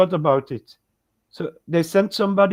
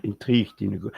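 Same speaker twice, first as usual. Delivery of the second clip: devil voice; 8 semitones lower, faster than usual. Pretend. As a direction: drop into a sinister devil voice. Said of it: "I shouldn't have used my devil voice".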